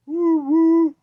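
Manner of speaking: high, loud train whistle woo; train whistle hoot. A narrator's reading. Kitten pretending to be a train, loud high whistle. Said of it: "cried Burley".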